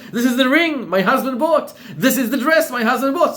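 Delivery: high, loud, laughing brag; proud showing off. Acted. Wife boasts to her friends about husband's gifts, high and laughing. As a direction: show off the gifts to the girls.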